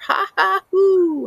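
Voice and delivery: high, loud ha ha hoo cackle; a wild creature jeering at leashed dogs; mocking animal laugh. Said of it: "I laugh so much".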